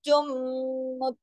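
जुम with a very long nasal m; merged ghunna. Shown as a demonstration. Teacher shows n merging into m, जुम held in the nose. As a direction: hum long on the end of जुम. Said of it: "जुन से जुम में चली जाएगी".

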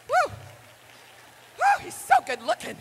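high giddy squeal, reliving the crush; giddy swoon. Retold or imitated. Preacher reliving her teenage crush, giddy squeal. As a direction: swoon like a teen girl again.

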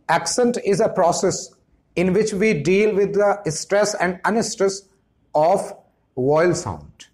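very slow, each word spaced out; slow dictation. Shown as a demonstration. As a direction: dictate it slowly for notes. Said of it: "slow dictation of definition".

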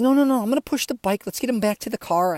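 high, loud retold refusal; insistent protest. Retold refusal to his worried cousin.

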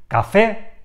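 "café" with the final é stressed; stress on last syllable. Shown as a demonstration. Teacher giving an example of an aguda word, final-syllable stress. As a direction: lean on the second syllable of "café". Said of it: "tiene el golpe de voz en la última sílaba".

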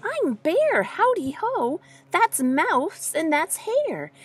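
high, sing-song bear introductions; friendly bear greeting. A narrator's reading. Bear introduces friends to the shy one, high and friendly. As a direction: pitch it up to greet a shy newcomer.

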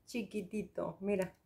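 hushed doting repeat; near whisper. Real, not acted. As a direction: breathe the word chiquitito as a tender echo. Cooing over the tiny product.

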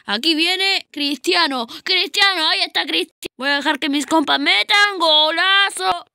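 high excited play-by-play; breakneck goal commentary. Real, not acted. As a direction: call it like a commentator. Narrates own play excitedly.

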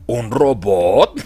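low, very slow rising question; exaggerated dopey reaction. Imitating stupid Superman's reaction to the robot, drawn out and dopey. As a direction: stretch it out like a dim-witted Superman. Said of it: "Su reacción es".

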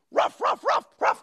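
high yappy pretend barks; dog barking. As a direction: bark like a happy puppy. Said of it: "I'm pretending to be a dog".

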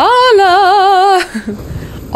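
loud, high burst of laughter; delighted high laugh. Real, not acted. Laughs at own voice.

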